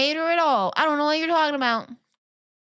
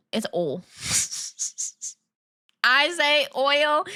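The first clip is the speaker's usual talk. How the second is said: normal talking voice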